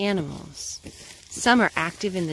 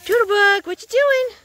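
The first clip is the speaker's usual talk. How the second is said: high, loud call to the pet; 12 semitones higher, 6 dB louder than usual. Real, not acted. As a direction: call out across the room. Calling to the pet.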